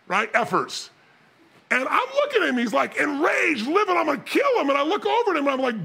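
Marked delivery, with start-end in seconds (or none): 3.0-4.7 intense, higher-pitched angry outburst; mock furious rant; imitated rage. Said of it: "he's so much more intense".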